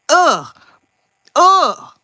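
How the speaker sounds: loud high ugh of disgust; disgusted groan. Real, not acted. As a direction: groan it out, fed up. Rant ends in disgust.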